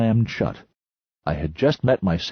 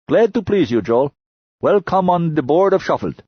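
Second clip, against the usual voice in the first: put-on broken English in a higher Lunar miner accent; 9 semitones higher, 5 dB louder than usual. A narrator's reading. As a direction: boast in a clipped foreign Lunar accent. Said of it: "He pronounced it like shuffled".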